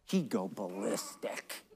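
put-on soft, slow kid confiding about dad; imitated hushed kid. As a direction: drop to a slow, hushed warning.

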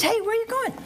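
blind man calling out. Acted. Blind man asking the rushing people again, calling out. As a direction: call up to passers-by, lightly acted.

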